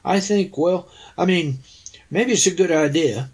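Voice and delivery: stuttering, unsure. Pretend. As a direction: trip over the words, unsure. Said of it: "if I'd stuttered and said".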